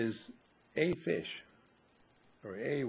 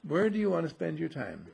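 ordinary delivery

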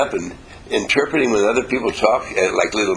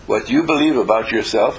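normal talking voice

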